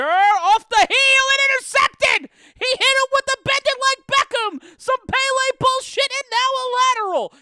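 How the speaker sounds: screaming high and loud in disbelief; explosive excited shout. Real, not acted. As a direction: lose it completely on the call, yelling. Stunned reaction to a wild interception.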